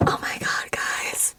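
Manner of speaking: soft, awed whisper. Real, not acted. Camper to viewers under northern lights, awed whisper. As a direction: whisper it to the camera in amazement. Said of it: "I'm whispering because it's pretty full".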